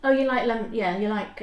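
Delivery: ordinary talk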